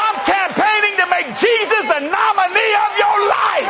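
high-pitched rallying pulpit shout; impassioned preaching. Acted. Preacher campaigning for Jesus before the crowd, shouting. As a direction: preach it high like a stump speech.

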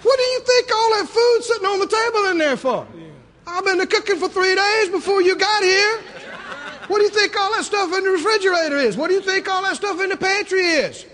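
Southern mother scolding her son; scolding Mama. Retold or imitated. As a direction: scold the boy lovingly, in disbelief. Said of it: "She said".

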